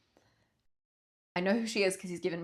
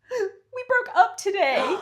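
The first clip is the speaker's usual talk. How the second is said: higher and slow, choked with tears; crying; 12 semitones higher, 6 dB louder, slower than usual. Retold or imitated. Girlfriend in the shop confessing the breakup, crying and slow. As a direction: sob the news out, voice rising. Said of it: "She starts crying".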